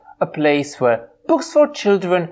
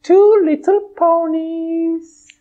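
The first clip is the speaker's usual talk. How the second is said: high bright pony voice; a cheerful high puppet pony; 10 semitones higher, slower than usual. Acted. Twilight puppet answering host, high pony voice.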